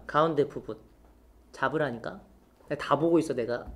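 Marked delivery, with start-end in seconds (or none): 1.5-3.6 mock threat. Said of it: "농담이에요".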